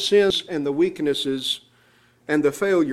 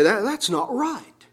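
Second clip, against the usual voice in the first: higher, slowly stressed indignant protest; indignant objection; 5 semitones higher, slower than usual. Retold or imitated. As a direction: retell righteous anger, mildly raised in pitch. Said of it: "it makes me angry".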